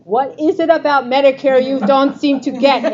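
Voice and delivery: put-on loud, brassy New York accent. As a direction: hit it like a pushy New Yorker from New York. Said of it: "in my New York accent".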